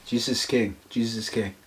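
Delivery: low, soft aside; hushed, low-pitched affirmation; quiet echo. Real, not acted. Quietly echoing the other's declaration.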